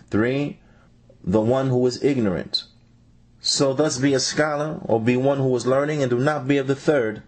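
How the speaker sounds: ordinary talk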